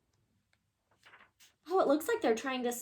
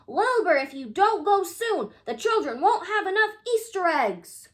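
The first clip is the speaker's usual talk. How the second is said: loud, high pleading call; urgent shout; 6 semitones higher, 6 dB louder than usual. A narrator's reading. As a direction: shout the warning to him. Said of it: "shouted Charles and Henrietta".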